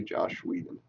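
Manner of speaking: whisper. Real, not acted. Signing off insult to Whedon.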